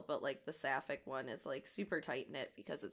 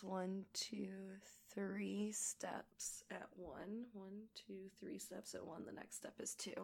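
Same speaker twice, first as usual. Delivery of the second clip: whispered counting; 3 semitones higher, 8 dB quieter than usual. Real, not acted. Counting beads under breath.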